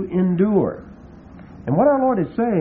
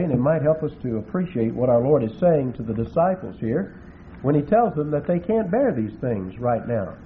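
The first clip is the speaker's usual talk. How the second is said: normal talking voice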